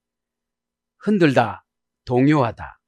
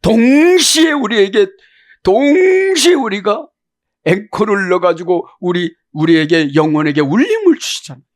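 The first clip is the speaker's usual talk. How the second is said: preaching at full force; 7 semitones higher, 7 dB louder than usual. Acted. Preacher proclaiming God comes from all directions at once. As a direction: lift it up and ring it out to the room.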